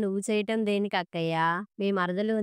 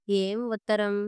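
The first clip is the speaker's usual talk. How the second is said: plain reading voice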